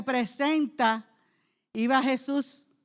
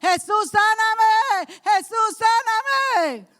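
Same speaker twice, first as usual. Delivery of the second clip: high, loud, slow shouted cry; shouting demand; 9 semitones higher, 5 dB louder, much slower than usual. Retold or imitated. Bartimaeus shouting at Jesus to be healed, high and loud. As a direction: yell it out as a demand. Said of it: "Él no estaba gritando".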